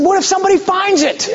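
half-laughing mock alarm; nervous laughing question. Asking Bob about the garbage bag, high nervous laugh. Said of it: "I said".

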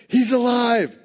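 high, loud joyful announcement; excited. Retold or imitated. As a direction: proclaim the news to friends, elated. Disciples talking and laughing.

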